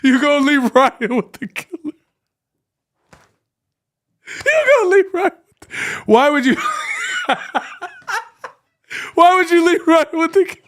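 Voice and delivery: can barely get the words out for laughing; laughing hysterically. Real, not acted. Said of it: "Oh, I can't breathe".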